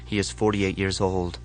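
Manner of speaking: ordinary delivery, nothing marked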